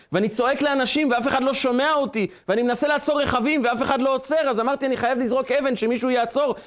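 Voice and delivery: high, urgent, unbroken appeal; acted desperate plea. Boy begs driver to lift his fallen brother, acted pleading. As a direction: plead for help as a helpless child, retold.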